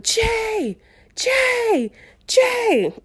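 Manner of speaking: high, drawn-out call for help; calling out, freaking out. Retold or imitated. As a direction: call to someone in another room. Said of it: "slightly freaking out".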